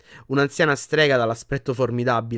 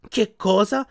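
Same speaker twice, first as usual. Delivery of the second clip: higher, louder stunned question; astonished; 8 semitones higher, 4 dB louder, slower than usual. A narrator's reading. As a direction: blurt it out in shock. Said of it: "disse Ron sbalordito". (Ron sbalordito)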